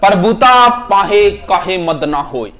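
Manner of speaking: proverb drawn out slowly, word by word; slow recitation. Shown as a demonstration. Teacher reciting the proverb slowly to students, slow deliberate recitation. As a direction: recite it slowly so the class can follow. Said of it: "proverb recited slowly".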